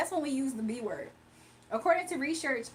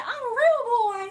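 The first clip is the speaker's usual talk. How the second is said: very high, loud mock whine; squeaky insistence on real; laughing viewer line voiced as Dimitri; Dimitri impression; 10 semitones higher, 8 dB louder than usual. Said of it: "somebody said".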